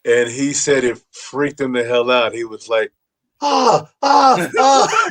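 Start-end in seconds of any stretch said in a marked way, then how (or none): 3.4-5.1 high, shocked yelps; shocked scream. Retold or imitated. Comic walking in on the couple, shocked yelps. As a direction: yelp like you walked in on something.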